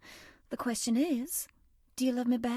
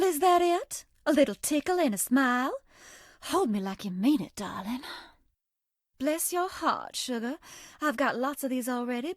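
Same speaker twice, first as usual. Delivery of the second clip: put-on a coy Southern girl sweet-talking a new friend; Southern belle character. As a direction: play the sweet, teasing Southern charmer. Voiced game character Bonnibel lines.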